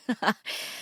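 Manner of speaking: short, soft chuckle; a quick laugh mid-announcement; amused chuckle. Acted. Radio host amused by Danielle's Hitchcock request, soft chuckle.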